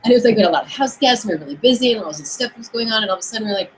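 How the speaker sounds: ordinary talk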